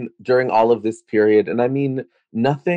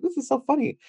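put-on girlish quote; 5 dB quieter, faster than usual. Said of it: "her daughter would show it to us".

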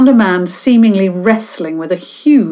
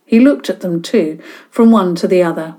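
plain reading voice

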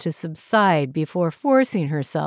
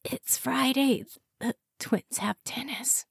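higher, soft, breathy; weak whisper; 8 semitones higher, 7 dB quieter than usual. A narrator's reading. Hungover wife reminding husband, whispering. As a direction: barely get it out.

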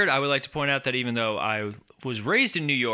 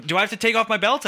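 high, fast mock dad; stern dad threat; 8 semitones higher, 4 dB louder, faster than usual. Father threatening his kid, a hammed-up stern dad. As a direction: rattle off a dad's belt threat. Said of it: "dad who's like".

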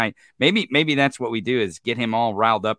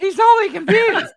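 very high squeaky cartoon voice; squeaky mockery; 6 dB louder than usual. Mocking a pundit on air with a very high squeak.

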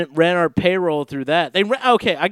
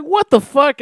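higher, louder incredulous outburst; mock outrage; 9 semitones higher, 6 dB louder than usual. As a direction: blurt it in disbelief. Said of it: "And it's like".

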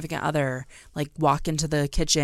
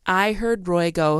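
ordinary talk

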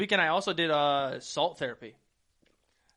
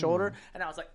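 ordinary talk, nothing marked

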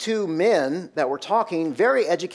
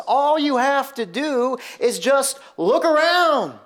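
high, slow, emphatic caller imitation; imitated caller's exasperated plea; exasperated radio caller; 9 semitones higher, 4 dB louder, slower than usual. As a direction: drive it home like a fed-up caller, stretching it out. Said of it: "And she goes".

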